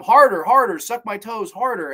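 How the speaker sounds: hammed-up order to go harder; mock yelling. Character yells at boyfriend, mock yelling. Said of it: "she's yelling at him".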